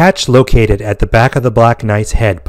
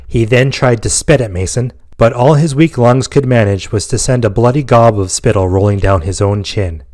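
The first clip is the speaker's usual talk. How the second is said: plain reading voice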